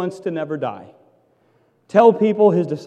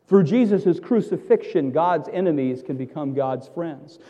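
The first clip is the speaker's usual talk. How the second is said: ordinary talk, nothing marked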